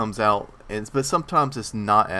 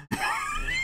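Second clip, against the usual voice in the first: squealing burst of laughter; high laugh; 5 dB quieter than usual. Real, not acted. Laughs at story.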